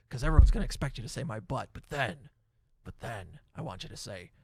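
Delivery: lightly voiced promoter building up to a reveal; Vince McMahon. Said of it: "that's my Vince McMahon impression".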